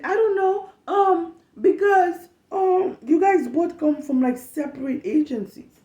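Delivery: high, whining mock voice; whiny mock coworker. Mocking the coworker's flustered excuse, high whine. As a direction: whimper the lame excuse.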